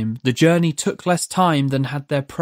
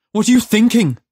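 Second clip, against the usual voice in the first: loud, higher demand; 7 semitones higher, 6 dB louder, faster than usual. A narrator's reading. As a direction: Judd demands an answer from the demon, loud. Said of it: "Judd demanded".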